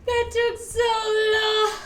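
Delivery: high, loud singsong wail; real dramatic wail; wailing complaint. Real, not acted. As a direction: moan it out to the chat. Lament after bad pull.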